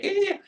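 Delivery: a very high, half-hearted little "Eh"; sheepish scream. Retold or imitated. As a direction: squeak it out timidly, very high. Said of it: "kind of sheepishly screamed at the mic".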